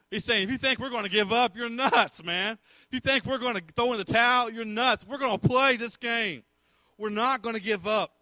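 high, loud, defiant sermon delivery; fired-up preaching. Acted. Sergeant refusing German surrender demand, defiant preaching. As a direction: throw the refusal back at them.

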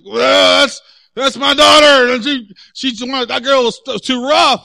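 loud, high-pitched outraged protest; mimicking a furious dad's outburst; imitated angry father. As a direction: complain loudly like an indignant sports parent. Acts angry father in quarrel.

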